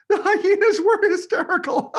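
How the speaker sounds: very high, loud cackling delivery; high giggling hyena voice; hysterical hyena laugh. Acted.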